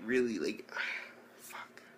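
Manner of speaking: breathed out, nearly silent and fed up; exasperated whisper. Real, not acted. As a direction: sigh it away under your breath. Exasperated aside to himself.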